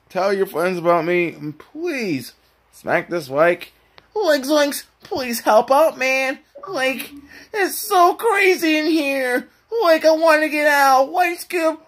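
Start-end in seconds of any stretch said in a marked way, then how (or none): 4.1-11.8 high, strained cartoon slacker voice; Shaggy. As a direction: whine high like a panicky cartoon sidekick. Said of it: "Shaggy wanna say something".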